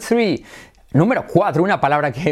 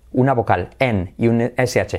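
normal talking voice

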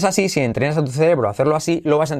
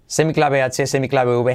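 ordinary talk, nothing marked

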